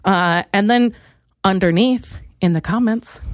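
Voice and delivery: ordinary talk, nothing marked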